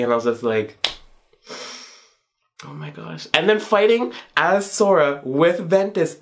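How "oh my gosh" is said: barely voiced, much softer oh my gosh; hushed gasp of disbelief; breathless awe. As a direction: let it slip out under your breath, stunned. Stunned by reunion scene.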